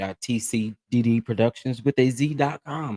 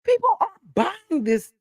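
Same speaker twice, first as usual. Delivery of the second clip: 1 incredulous. Real, not acted. Disbelief at cookie sales.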